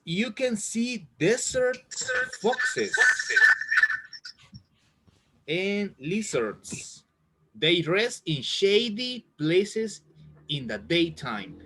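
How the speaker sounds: slow, careful read-aloud of the textbook sentence; reading aloud to class. Acted. Teacher reads the book sentence to her students, slowly and clearly. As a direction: read it out word by word so learners can follow.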